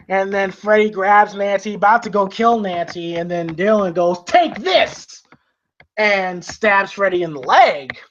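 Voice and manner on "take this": battle cry. Retold or imitated. Dylan stabbing Freddy, retold.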